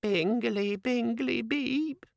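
high, rising chirpy jingle; very high-pitched hopeful electronic imp; hopeful little demon. A narrator's reading. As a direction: chirp it like a tiny gadget alert. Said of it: "a voice very near ground level said hopefully".

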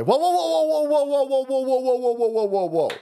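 long high-pitched run of whoas; rapid-repeat stop-everything cry, starting high; mock alarm. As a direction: halt them with a sustained, gradually sinking chant. Halts the article in mock alarm.

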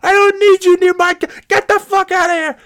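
high, loud hammed-up shouting; mock furious yell. Stranger in car screaming at narrator, mock furious. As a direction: bark him away like a cornered maniac.

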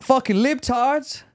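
very high, slow hammed-up Southern drawl. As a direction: spit it like an angry hillbilly. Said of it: "The accent's not bad".

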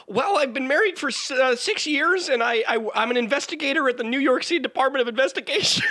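high, loud, fast squeaky lizard voice; squeaky little lizard voice. Acted. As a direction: chirp it out like a nervous little lizard. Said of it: "my squeaky little lizard voice".

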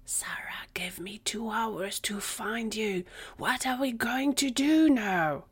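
urgent, high-pitched whisper. A narrator's reading. Gary updates his friends after being shushed, whispering. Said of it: "He instantly started whispering".

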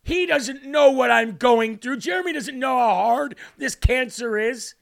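loud, high mock outrage; indignant. Preacher imitating a proud listener's thoughts, indignant. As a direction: act out an offended, protesting listener. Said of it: "You gotta stop thinking".

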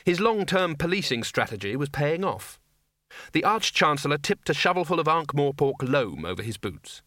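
ordinary delivery, nothing marked

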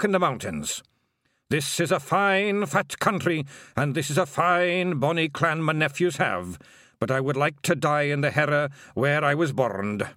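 a hint of a sorrowful old Feagle elder; old Feagle William. A narrator's reading. William speaks.

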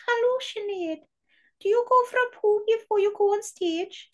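high-pitched Geordie skit voice; Geordie accent impression. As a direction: do a squeaky comic Geordie. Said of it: "my Geordie accent is".

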